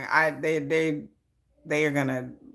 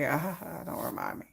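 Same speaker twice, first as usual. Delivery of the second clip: muttering; 4 semitones lower, 12 dB quieter than usual. Retold or imitated. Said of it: "I'm like".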